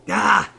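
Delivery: short, high, loud scream; brief startled yell; acted scream. A narrator's reading. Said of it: "I screamed as loud as I could".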